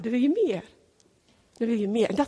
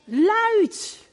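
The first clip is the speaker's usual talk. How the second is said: normal talking voice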